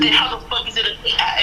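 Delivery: baffled. Acted. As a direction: question it in mock disbelief. Naruto acted, baffled objection.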